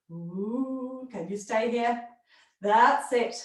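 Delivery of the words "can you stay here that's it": ordinary delivery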